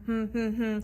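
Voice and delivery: three even, level hums; humming. Shown as a demonstration. Teacher counting Valentine's syllables, humming. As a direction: hum one beat per syllable. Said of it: "Let's hum it".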